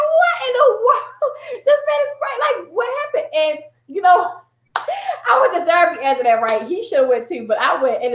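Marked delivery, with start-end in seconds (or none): 0.1-3.3 high, laughing shocked cry; stunned disbelief. Retold or imitated. Wife reacting to crazed husband, high and shocked. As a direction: squeal it in disbelief.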